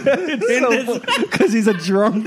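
high words squeezed out through laughter; helpless laughing. Real, not acted. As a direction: struggle to speak while cracking up. Still cracking up at the joke.

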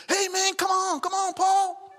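high, loud call down the street; friends calling out. Retold or imitated. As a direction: yell to a friend to come ride.

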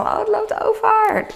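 put-on high, slow, thrilled child's voice; excited kids. Kids at the McDonald's party, high and thrilled. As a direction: squeal it like a little kid watching ice cream spill. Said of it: "heel spannend".

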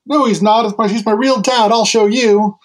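put-on high, loud defiant boy insisting; indignant protest. Boy to his mocking friends, high loud indignant protest. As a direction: hotly defend his father's honour. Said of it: "and he says".

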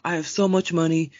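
high, slow, stuck-up voice; hammed-up snobby imitation; mocking snooty girl. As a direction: parody her bragging. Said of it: "she's like".

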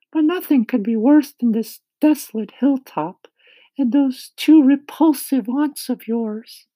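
high-pitched, loud character voice; kindly ladybug. A narrator's reading. Ladybug telling James of their departure, high, loud voice. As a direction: speak up high as an eager ladybug.

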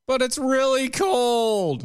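high, slow drawn-out whine; whiny kid. Retold or imitated. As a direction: stretch the whine like a cold kid.

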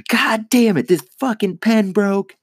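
fed-up yell at a broken weed pen; retold angry outburst. Comedian reenacting his outburst at a broken pen, loud and high. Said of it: "I was just like".

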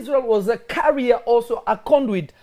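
loud, fervent preaching. Acted. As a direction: build the sermon to a shout. Preaching builds to full force.

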